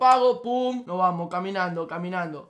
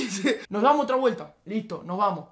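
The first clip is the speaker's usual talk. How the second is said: ordinary talk, nothing marked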